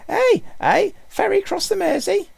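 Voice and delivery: very high, loud squeaky mock Scouse; squeaky Liverpudlian impression. A narrator's reading. Passenger mocks a woman from Liverpool, squeaky bad Scouse impression. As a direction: squeal out a terrible Liverpudlian accent. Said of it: "squeaking in a high voice".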